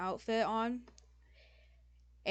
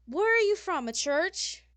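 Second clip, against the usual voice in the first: high, loud diva scolding; theatrically scandalised fashion critic; appalled fashion designer; 8 semitones higher, 8 dB louder than usual. Acted. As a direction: tear into them like an offended designer.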